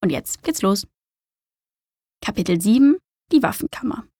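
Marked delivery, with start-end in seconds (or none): none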